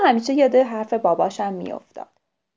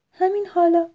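raised, emphatic confirmation; mother's firm answer; 7 semitones higher, 5 dB louder than usual. A narrator's reading. Mother owl answers her nervous owlet, firm and emphatic. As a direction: answer the worried child firmly. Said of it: "مامانش گفت".

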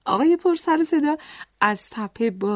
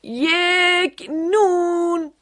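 very slow, higher shouted words; shouting; 4 semitones higher, much slower than usual. A narrator's reading. Noisy man repeating his order to the baker, slow shout. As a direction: call out each word, stretched long. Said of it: "فریاد زد".

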